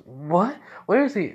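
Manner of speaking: high, loud mock alarm; acted-out startled demand. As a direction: blurt it out in shocked disbelief. Mother learns Izuku is gone.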